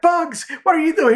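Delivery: very high cartoon duck; Daffy voice. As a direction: pitch it way up as Daffy bursting in.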